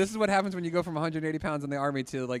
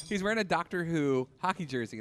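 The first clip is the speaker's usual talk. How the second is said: ordinary talk, nothing marked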